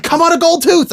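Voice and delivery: high, loud imitation of the wrestling manager; Jim Cornette impression. Co-host quotes the manager's catchphrase back in a high squeal. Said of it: "I can hear him now".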